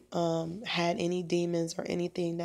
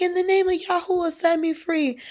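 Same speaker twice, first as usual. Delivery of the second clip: high, fast frightened repetition; desperate repeating; 12 semitones higher, 5 dB louder than usual. Retold or imitated. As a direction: rush the words back in fear. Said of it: "he was like".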